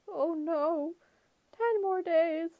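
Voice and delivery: high, haggling falsetto. A narrator's reading. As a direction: bargain sweetly in falsetto. Grover's falsetto.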